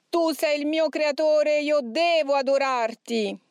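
high, slow, with "devo" hammered hard; stress on devo. A narrator's reading. Demon bowing to its creator, "devo" heavily marked, high. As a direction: force out "devo" with striking emphasis. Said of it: "rimarcandola con un tono di voce impressionante".

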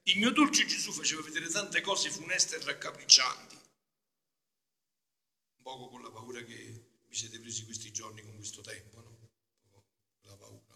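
normal talking voice

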